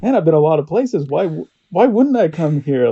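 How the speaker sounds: high, loud protest to oneself; retold disbelief. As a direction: think aloud, loudly incredulous. Retold own indignant reaction.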